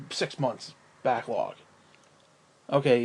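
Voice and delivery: normal talking voice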